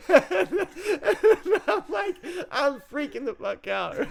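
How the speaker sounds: high-pitched, breaking up laughing. Real, not acted. Telling listeners of being lost in Mexico, laughing. Laughing through his own story.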